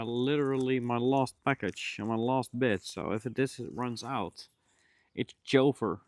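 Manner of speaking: ordinary talk, nothing marked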